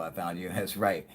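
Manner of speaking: usual speaking voice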